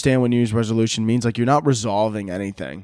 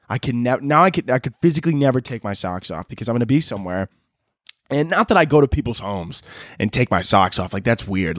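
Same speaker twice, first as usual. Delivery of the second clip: ordinary talk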